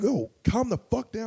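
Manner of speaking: retold stern order; mock stern warning. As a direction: tell him off sharply. Retold telling him to calm.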